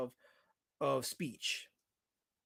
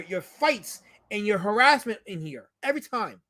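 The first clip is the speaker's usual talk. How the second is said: raised, louder complaint to the room; exasperated scolding; 4 semitones higher, 7 dB louder than usual. Real, not acted. Streamer can't teach chat without fights, exasperated scolding.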